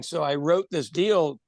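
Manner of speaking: ordinary delivery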